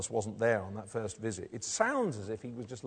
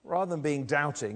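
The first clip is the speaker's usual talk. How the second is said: ordinary talk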